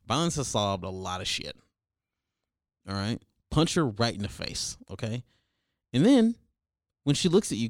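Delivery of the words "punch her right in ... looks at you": ordinary delivery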